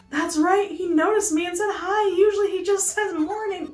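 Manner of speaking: high, eager lovestruck character; Pelly. Acted. Pelly tells friend about Pete's greeting, high and eager. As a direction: pitch it up, gushing over a crush.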